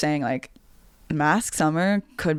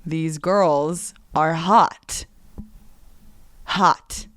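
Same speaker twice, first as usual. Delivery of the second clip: slow admiring drawl; much slower than usual. Retold or imitated. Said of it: "I was like".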